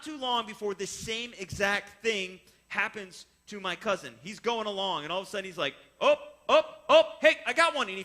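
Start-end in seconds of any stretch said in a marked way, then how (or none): 6.0-7.9 put-on high, loud excited shouts of a kid hooking a fish; excited cry. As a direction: yell it like you just felt the fish bite. Cousin hooks his fish.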